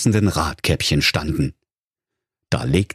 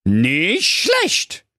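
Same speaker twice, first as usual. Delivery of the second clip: high cheeky dog voice; Doktor Schulz; 8 semitones higher than usual. Acted. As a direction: call it out, mock impressed. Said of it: "rief da der Köter". (frecher Hund, laut gerufen)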